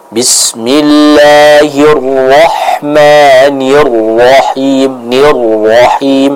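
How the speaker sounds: Bismillahirrahmanirrahim very slow, rr of Nirrahim pressed hard; stressed ro tasydid. Shown as a demonstration. Teacher demonstrating the pressed ro, very slow recitation. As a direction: lean hard on the doubled r in Nirrahim. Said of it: "untuk huruf ro ditekan".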